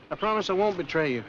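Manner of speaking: ordinary talk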